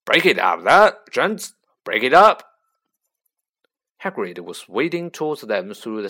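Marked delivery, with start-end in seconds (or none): 0.0-2.6 very loud booming order; loud shout. A narrator's reading. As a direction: bellow over the whole crowd. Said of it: "louder than all".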